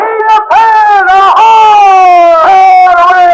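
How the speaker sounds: loud hail across the water; calling out ahoy. Acted. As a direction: shout to carry far off the ship. Hailing Pan from ship.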